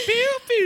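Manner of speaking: very high squeaky pew pew; a tiny reggae air horn; air horn noise. Pretend. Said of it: "Cutest little reggae horn".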